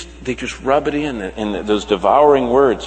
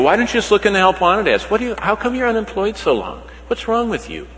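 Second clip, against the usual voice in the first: higher, fast, needling questions; mocking nosy acquaintance; 9 semitones higher, faster than usual. Pastor mimics people taunting the unemployed, mocking and higher. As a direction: mimic a prying busybody's digs. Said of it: "they make those little comments".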